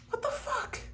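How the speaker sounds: sudden high squeal of disbelief; shocked outburst. Real, not acted. Reader shocked by the tarot spread, high squeal. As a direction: yelp it in real shock at the cards.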